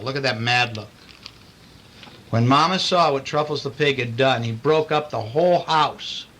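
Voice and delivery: ordinary delivery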